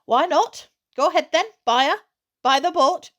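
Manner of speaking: a mother suddenly all for it; mother won over. Acted. Mother agrees excitedly.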